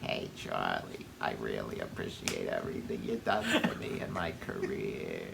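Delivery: low breathy whisper. Pretend. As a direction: whisper the goodbye softly. Whispered mock farewell.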